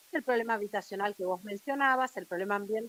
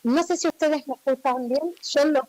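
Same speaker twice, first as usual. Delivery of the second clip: loud, boomy phone call right on the mic; too close to mic; 9 dB louder than usual. Real, not acted. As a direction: speak with the phone pressed to your mouth. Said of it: "muy pegada al micrófono del teléfono".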